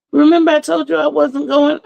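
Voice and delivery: high, pleading retort; retold protest. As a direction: squeak out the reminder. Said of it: "I'm like".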